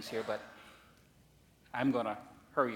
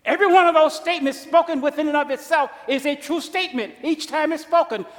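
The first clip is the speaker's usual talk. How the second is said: higher, louder preaching climax; fervent preaching; 14 dB louder than usual. Acted. Preacher rousing the congregation in a loud build. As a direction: drive it home from the pulpit.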